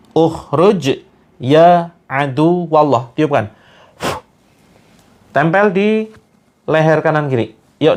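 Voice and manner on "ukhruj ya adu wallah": Ukhruj ya adu wallah spoken very slowly, each word drawn out; slow recital demo. Shown as a demonstration. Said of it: "Ikuti teman-teman". (dibacakan sangat pelan agar diikuti jamaah)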